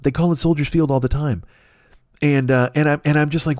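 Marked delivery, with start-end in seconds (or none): none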